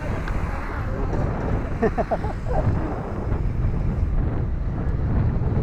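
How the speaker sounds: airy high giggle; breathy laugh. Real, not acted. Laughing at the view.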